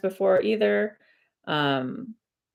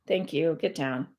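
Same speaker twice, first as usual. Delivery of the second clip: ordinary talk, nothing marked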